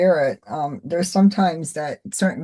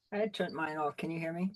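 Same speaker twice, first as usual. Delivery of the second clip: faint, distant voice; speaking well away from the microphone; far from mic; 14 dB quieter than usual. Real, not acted. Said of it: "it's kinda low".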